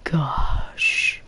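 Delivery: hushed, breathy whisper. Real, not acted. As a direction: whisper it under your breath. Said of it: "why am I whispering now".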